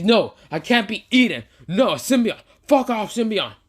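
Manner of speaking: high, loud frantic protest; panicked cry. Acted. Kimishiki refusing to be eaten, panicked. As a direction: plead in panic.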